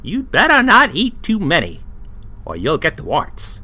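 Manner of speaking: high waitress voice giving a warning. Acted. The waitress warning about the mushrooms, high voice. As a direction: warn him off in a high voice.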